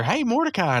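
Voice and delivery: a hint of Margaret calling across the court. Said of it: "you hear".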